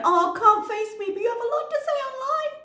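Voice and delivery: high, sneering mock of her message; mocking sing-song taunt. Reading out her taunting text as a high, sneering mock.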